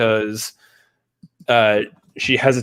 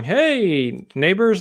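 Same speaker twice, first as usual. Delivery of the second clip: high, drawn-out neighborly hello; calling out greeting; 8 semitones higher, much slower than usual. Retold or imitated. Vision out front greets his neighbors, calling out high. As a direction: sing out a greeting, pitched up. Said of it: "Vision's out there going".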